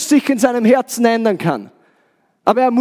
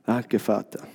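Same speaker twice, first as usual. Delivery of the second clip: tief und sehr leise, kaum über Murmellautstärke; leises Gebet; 11 dB quieter, slower than usual. Real, not acted. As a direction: leise zu Gott beten, Stimme gesenkt. Leises Gebet zwischen den Predigtteilen. (leise gemurmeltes Dankgebet)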